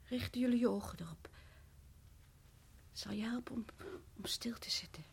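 low, soft, unsettling whisper; eerie warning whisper. Acted. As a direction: whisper it like a spell. Wife's creepy warning to guests.

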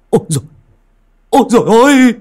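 high, loud cries of alarm; startled servant yelling, pitch shooting up; urgent startled calling. A narrator's reading. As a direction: call out sharply to wake the master. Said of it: "gọi giật giọng".

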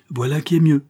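plain reading voice

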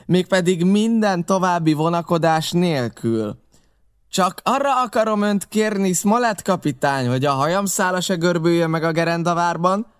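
high, loud, wheedling pirate voice; Long John pleading terms before the blockhouse; Silver negotiating. A narrator's reading. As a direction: bargain boldly for safe passage. Silver bargains with the captain.

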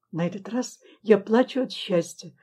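plain reading voice

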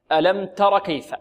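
تر said short, with no long final alif; short alif dropped. Shown as a demonstration. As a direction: cut تر short, no long vowel. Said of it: "لا نقول ألم ترى كيف".

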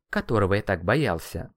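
usual speaking voice

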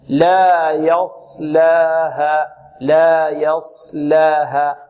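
very slow, drawn-out practice reading; slow, letter by letter. Shown as a demonstration. Teacher showing beginners how to practise, slowly letter by letter. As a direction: stretch each sound out separately. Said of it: "يقرأ ببطء".

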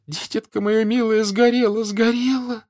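high, keening wail; sobbing. A narrator's reading. Mother mourns her burnt child, sobbing. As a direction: wail in grief, voice pitched high. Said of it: "И опять она зарыдала".

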